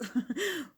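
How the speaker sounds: short laugh at the claim; amused laugh. Real, not acted. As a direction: laugh it off lightly. Laughs at American accents claim.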